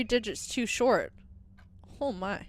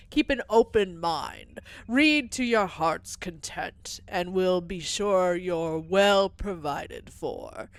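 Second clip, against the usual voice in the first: slow, lower, calm priestly welcome; serene monk voice; 3 semitones lower, slower than usual. Acted. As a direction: welcome the traveler like a gentle monk. Voicing robed library figure.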